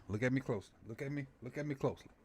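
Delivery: hushed intense. Pretend. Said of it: "You see, you see my eyes?".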